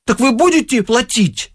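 high, insistent question; pointed high-pitched query; young lady clerk. A narrator's reading. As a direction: press the buyer in a high female voice. Clerk asks the buyers.

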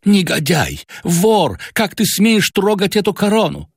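high, outraged shout; furious accusation. A narrator's reading. Father catches his son holding the crown, furious. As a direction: rage at the thief.

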